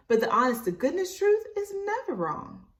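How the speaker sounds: truth lifted high and stressed; earnest emphasis. Acted. Mama teaching Libby about truth, with high stress on truth. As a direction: land the lesson on truth. Mother's lesson.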